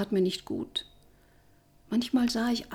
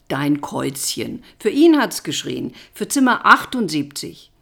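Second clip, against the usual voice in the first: triumphant; 4 semitones lower, 8 dB louder than usual. Acted. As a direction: rub it in triumphantly. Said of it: "triumphierte mein Freund". (triumphierend, langsam dann lauter)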